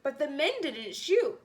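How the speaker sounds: ordinary delivery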